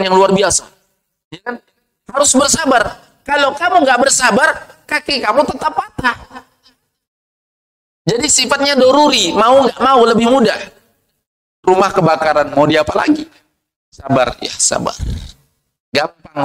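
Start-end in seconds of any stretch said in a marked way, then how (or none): none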